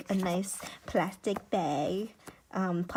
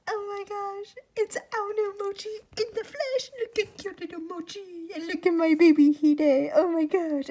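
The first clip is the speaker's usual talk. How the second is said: high-pitched gushing, building louder; excited squealing; 9 semitones higher, 6 dB louder than usual. Real, not acted. Gushing over a friend's character art, excited squeal. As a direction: squeal it, swelling with delight. Fangirling over the received artwork.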